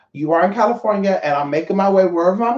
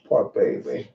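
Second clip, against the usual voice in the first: tender coo; 12 semitones lower, 5 dB quieter, slower than usual. Real, not acted. Cooing to sleepy pet.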